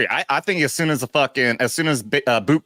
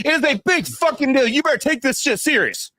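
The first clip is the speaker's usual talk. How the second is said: mock outrage; 10 semitones higher than usual. Mock-outraged rant at Mike.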